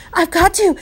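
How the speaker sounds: breathless, high and loud; panting. A narrator's reading. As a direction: gasp it out, straining. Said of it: "he panted".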